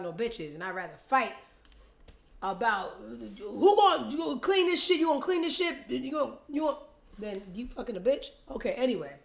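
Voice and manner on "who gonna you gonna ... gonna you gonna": high, loud nagging demand; mock argument. Fighting with Skip about chores, loud and high. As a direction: scold like a fed-up girlfriend. Acted quarrel with partner.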